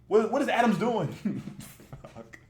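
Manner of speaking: ordinary talk, nothing marked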